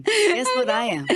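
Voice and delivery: laughing. Real, not acted. Host teases guest to guess her type, laughing.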